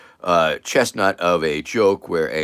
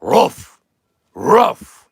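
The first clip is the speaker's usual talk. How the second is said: high, loud, separate ruffs; dog bark; 10 semitones higher, 8 dB louder than usual. Acted. Dog answers the sandpaper question, barking. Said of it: "And the dog goes".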